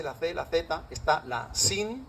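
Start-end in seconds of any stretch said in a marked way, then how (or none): 1.5-2.1 "sin" drawn out with a plain s; Arabic letter name. Shown as a demonstration. Lecturer listing Arabic sibilants to students, clear s. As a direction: say "sin" slowly, with a clear hissed s. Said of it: "cinco sonidos que se parecen a la S".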